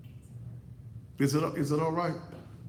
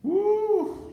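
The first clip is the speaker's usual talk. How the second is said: high, sharp woo of amazement; excited whoop; 5 dB louder than usual. Real, not acted. Preacher reacting to his own line, high excited woo. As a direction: let out a high delighted woo.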